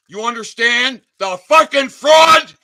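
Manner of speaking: loud, high angry yell; furious shout. Real, not acted. Ranting vlogger accusing police of fraud, furious shout. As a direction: shout it in outrage.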